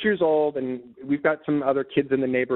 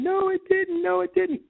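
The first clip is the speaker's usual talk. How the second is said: put-on much higher, childlike tearful wail; retold wail through tears. Boy running away from the kids, high tearful wail. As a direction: cry it out like a kid running off. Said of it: "I'm just in tears running away going".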